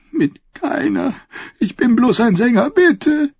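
very high, whimpering plea; tearful begging. A narrator's reading. Prisoner begs his interrogators to believe him, tearful. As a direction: beg them in despair.

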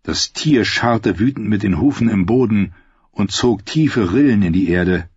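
usual speaking voice

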